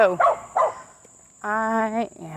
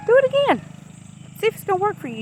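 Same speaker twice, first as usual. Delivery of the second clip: emphatic urging; 13 semitones higher, much faster than usual. Real, not acted. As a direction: urge them on, voice leaping up. Urging viewer to repeat diet.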